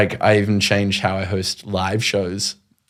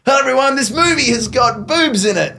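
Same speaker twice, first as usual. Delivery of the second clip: hyped stage host; 8 semitones higher than usual. Retold or imitated. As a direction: blast it to the back of the room like a hype host. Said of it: "do a Mister Beast, but, like, live".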